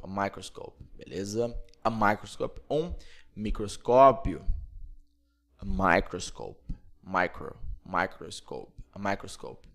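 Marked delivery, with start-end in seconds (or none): none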